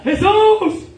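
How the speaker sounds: high, loud cry of Jesus from afar; lepers shouting. Retold or imitated. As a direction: call out Jesus as a desperate leper far off. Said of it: "nagsisigaw sila".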